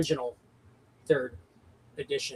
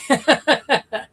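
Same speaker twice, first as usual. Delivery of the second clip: short amused laugh; 9 semitones higher, 5 dB louder than usual. Real, not acted. Laughing at fiddly mechanics.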